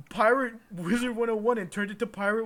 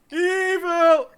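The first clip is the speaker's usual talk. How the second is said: loud; 11 semitones higher, 12 dB louder than usual. Acted. Judge naming the crime.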